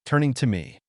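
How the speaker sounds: ordinary delivery, nothing marked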